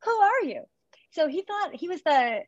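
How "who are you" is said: high incredulous question; squeaky disbelief at the stranger; baffled. Retold or imitated. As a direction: sound utterly puzzled. Retelling meeting yelling coach.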